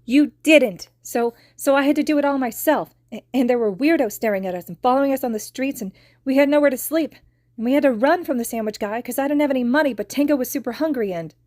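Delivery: put-on high, loud upset child; little girl venting her hurt; tearful tirade. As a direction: pour it all out in a rush.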